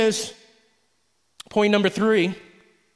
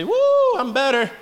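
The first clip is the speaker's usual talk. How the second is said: high loud woo, then relief; mock worship whoop; 6 semitones higher, 4 dB louder than usual. Worshipper shouting relief in the service, high woo. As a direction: whoop like freed from guilt. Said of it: "you say".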